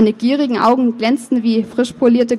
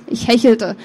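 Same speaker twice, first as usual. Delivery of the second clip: usual speaking voice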